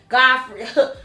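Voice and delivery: usual speaking voice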